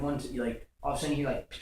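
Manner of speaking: ordinary talk, nothing marked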